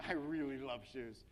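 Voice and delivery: slow, drawn-out mock gushing; mock gushing bio. Coach acting out an engineering VP's web bio, mock gushing. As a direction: lightly act out a shoe lover's gush. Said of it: "this is what her bio".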